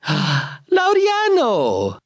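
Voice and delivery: high, long drawn-out squeal of the name; squealing in surprise. Acted. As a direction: shriek the name in shock. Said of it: "Giovanni squealed".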